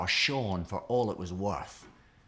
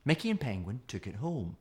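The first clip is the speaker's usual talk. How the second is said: ordinary delivery, nothing marked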